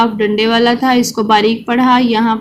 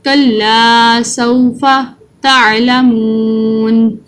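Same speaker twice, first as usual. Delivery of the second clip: slow, drawn-out tajweed recitation; Quran recitation; much slower than usual. Shown as a demonstration. Teacher reciting the surah for children to follow, slow chanted recitation. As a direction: chant each word slowly for children to repeat.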